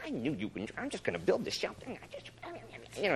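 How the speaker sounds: sulky muttering, trailing into grumbles; Jonah whining and complaining. Retold or imitated. Jonah building his shelter in a sulk, grumbling. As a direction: grumble it quietly under the breath. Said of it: "barking like this and whining and complaining".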